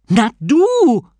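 loud, high outburst; exasperated shout. Acted. Novice throws the answer back at god, exasperated shout. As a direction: blurt it out as if it were obvious.

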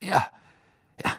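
quick, high, brusque agreement; hasty, irritated minister; impatient. A narrator's reading. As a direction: brush it off and hurry on. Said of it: "sagte Fudge ungeduldig".

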